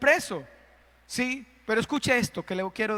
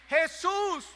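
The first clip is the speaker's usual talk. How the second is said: raised, higher, slower call to a suffering man; calling out to Jesus; 7 semitones higher, 3 dB louder, slower than usual. Acted. Preacher calls out to Jesus carrying the cross, raised high voice. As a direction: call out high and loud, a touch slow.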